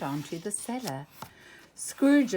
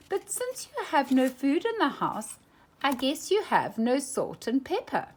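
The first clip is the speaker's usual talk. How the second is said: higher, sing-song coaxing; sly baiting; 7 semitones higher, 4 dB louder than usual. A narrator's reading. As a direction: tease the miser into giving. Daisy baiting uncle.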